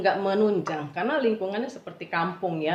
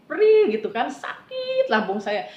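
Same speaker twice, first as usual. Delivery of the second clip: high, loud, drawn-out words of pain; mock wince, stretched and pushed up high; retold pain; 6 semitones higher, 6 dB louder than usual. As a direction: stretch out the hurt as you relive it. Reliving stabbing stomach pain from fasting.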